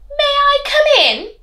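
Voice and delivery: high, loud sly wolf voice; funny wolf voice. Acted. Wolf asking grandma to let him in, high funny voice. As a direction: put on a cheeky wolf at the door. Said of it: "all of the funny voices".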